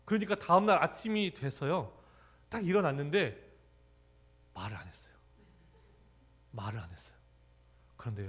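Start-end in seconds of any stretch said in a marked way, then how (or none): none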